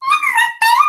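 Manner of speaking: very high mouse squeak, rising; super squeaky mouse voice. Shown as a demonstration. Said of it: "Super squeaky voice".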